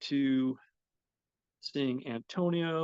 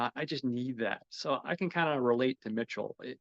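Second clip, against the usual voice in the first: ordinary talk, nothing marked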